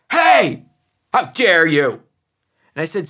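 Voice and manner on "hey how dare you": put-on child's indignant protest. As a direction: imitate a six-year-old's loud indignant protest. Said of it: "he protested".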